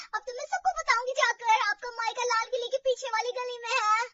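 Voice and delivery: high, loud announcement; excited. Acted. Sister-in-law gleefully threatens to tell everyone, high and loud. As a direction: shout the news with delight.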